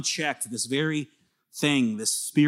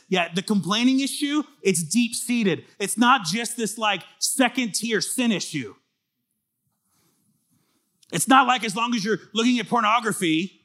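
higher, louder, driving sermon voice; full-force preaching; 9 semitones higher, 5 dB louder than usual. Acted. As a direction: preach it hard to the congregation.

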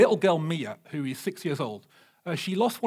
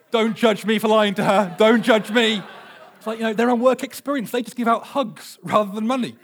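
laughing plea; 4 semitones higher, 7 dB louder than usual. Real, not acted. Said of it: "laughing plea to congregation".

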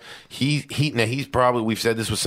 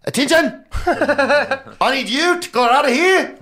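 put-on much higher, loud yelled South African accent with sharp A's; 6 dB louder, slower than usual. Imitating a comedian's South African airline yell, far higher and louder. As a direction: bark orders in a shrill South African accent. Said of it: "you hear the way the A's go?".

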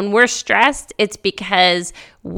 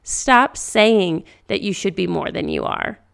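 ordinary talk, nothing marked

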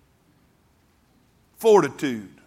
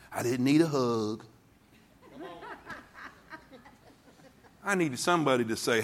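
softer, lower preaching; quiet and earnest; 3 semitones lower, 6 dB quieter than usual. Acted. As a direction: pull them in close, softly. Preacher drops low after the shouting.

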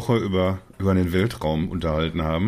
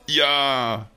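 long, higher, mock-euphoric "yeah"; imitated cheer; 5 semitones higher than usual. Guessing a friend's reaction to the launch, mock cheering. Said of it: "so die Hände in die Luft gereckt".

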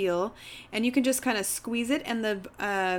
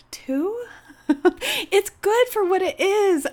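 awkward laugh; 10 semitones higher, 6 dB louder, slower than usual. Real, not acted. Awkward low rating.

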